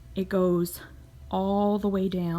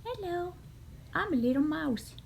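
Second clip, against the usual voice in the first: tiny squeaky mouse voice; squeaky cartoon voice; 9 semitones higher, 5 dB quieter than usual. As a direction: speak as a little cartoon mouse. Voicing a mouse print.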